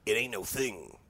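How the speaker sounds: low catchphrase voice. Pretend. Rhyming catchphrase, low put-on voice.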